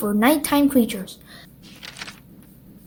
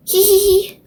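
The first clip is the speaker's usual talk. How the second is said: high giggling hee hee hee; little pig giggling in the mud; giggle; 7 semitones higher than usual. A narrator's reading. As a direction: giggle like a happy piglet. Everyone laughing in mud.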